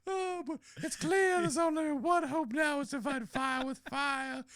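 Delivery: high drawling Scarlett O'Hara voice; Southern belle voice. Acted. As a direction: falsetto Southern lady. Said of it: "She's, she's Scarlett O'Hara".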